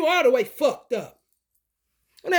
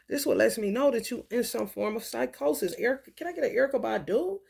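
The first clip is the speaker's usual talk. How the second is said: usual speaking voice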